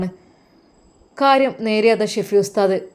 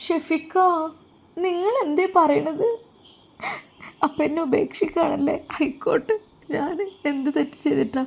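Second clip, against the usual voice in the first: higher and softer, a shocked wife pleading; stunned hurt plea; 7 semitones higher, 4 dB quieter than usual. Acted. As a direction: pull back in disbelief, voice small and high. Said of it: "അവൾ ഒരു നിമിഷം ഞെട്ടിത്തരിച്ചു പോയി".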